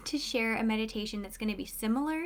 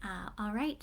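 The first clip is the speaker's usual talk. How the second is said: ordinary talk, nothing marked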